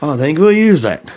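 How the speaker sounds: a weak, lightly put-on English accent. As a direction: do a half-hearted English accent, playing the gracious star. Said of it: "my English accent's not good".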